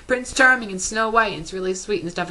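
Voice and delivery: high, sugary recap voice; mock sweet gushing. Vlogger recapping the third episode's romance, high mock gushing. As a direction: gush over it in a sing-song. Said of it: "it's like".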